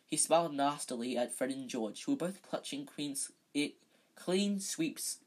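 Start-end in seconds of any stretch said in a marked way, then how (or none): none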